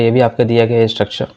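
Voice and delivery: ordinary talk, nothing marked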